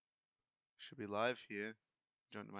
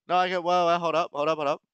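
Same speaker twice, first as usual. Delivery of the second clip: raised voice, talking over someone; louder and higher, cutting her off; loud protest; 7 semitones higher, 8 dB louder, faster than usual. Real, not acted. Arguing with wife about sound.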